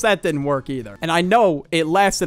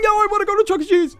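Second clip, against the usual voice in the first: put-on much higher, whiny little kid begging; mock childish wail; desperate kid pleading; 3 dB louder, faster than usual. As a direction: plead like a kid scared to lose the treat. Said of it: "of course, I'd break".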